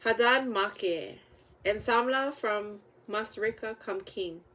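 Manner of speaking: ordinary delivery, nothing marked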